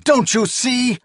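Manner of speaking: high loud desperate cry; despairing cry. A narrator's reading. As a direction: cry it out hopelessly. Her despairing outburst continues.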